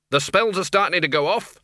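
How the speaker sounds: moderately loud alarm; shouted. A narrator's reading. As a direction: call out an urgent warning. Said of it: "shouted the Dean".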